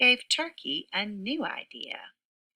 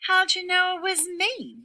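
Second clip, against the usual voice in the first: very high, loud wail; wailing turkey; 13 semitones higher, 4 dB louder than usual. Acted. Turkey found out by Sheep, very high wail. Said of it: "wailed Turkey".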